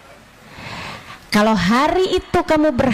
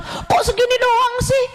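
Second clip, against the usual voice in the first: higher, whiny complaining voice; mock complaining; 10 semitones higher than usual. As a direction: mimic a grumbling ungrateful person, half-crying. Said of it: "keluh kesah mulu".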